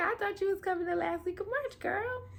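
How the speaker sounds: high, fast teasing voice; sassy high mock scolding. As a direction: tease yourself for being so sure. Mocking her past self.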